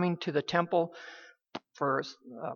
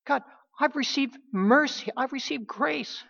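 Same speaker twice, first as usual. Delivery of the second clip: much higher, swelling praise; rising fervor; 8 semitones higher than usual. Acted. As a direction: lift each "I've received" higher and higher. Preacher voices Isaiah's grateful response.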